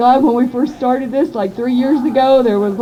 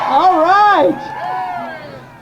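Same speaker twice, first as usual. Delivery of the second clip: higher shouted call; rally shout; 7 semitones higher than usual. Real, not acted. Organizer calling out to the crowd between speakers, shout. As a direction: lift the crowd up.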